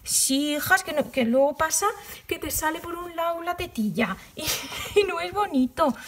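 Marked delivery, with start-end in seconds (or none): 2.3-5.9 higher voice breaking into a chuckle; amused laughing. Real, not acted. Vlogger warning viewers about a gaping armhole, amused laughing. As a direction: let a real giggle interrupt the words. Laughs at her cheeky remark.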